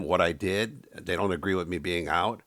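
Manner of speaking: ordinary talk, nothing marked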